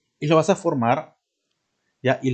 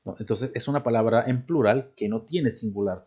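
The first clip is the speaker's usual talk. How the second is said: normal talking voice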